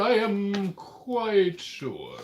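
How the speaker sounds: slow, high, hammed-up confidence; mock dramatic. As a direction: stretch it out with fake grandeur. Mock-dramatic reply to challenge.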